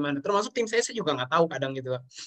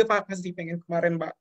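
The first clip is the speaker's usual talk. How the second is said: tearful; 2 semitones higher than usual. Real, not acted. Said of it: "Aduh, saya rada cengeng".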